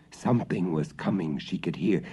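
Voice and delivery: usual speaking voice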